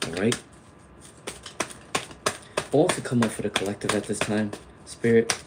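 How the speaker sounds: low, hushed prayer to the spirit guides; soft invocation. Acted. Reader invoking spirit guides for her viewers, hushed and reverent. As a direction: call on the guides softly and reverently. Repeats invocation while shuffling.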